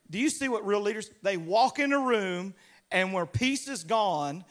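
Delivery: ordinary talk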